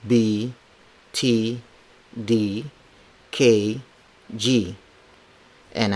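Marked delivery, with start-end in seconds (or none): none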